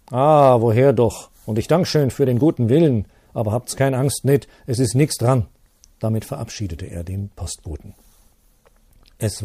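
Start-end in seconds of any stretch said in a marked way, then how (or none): 0.1-5.5 loud, hearty dismissal in dialect; priest reassuring. Acted. Priest Sepp brushing off the postman's worry, loud and hearty. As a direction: wave off the worry with a booming laugh in the voice.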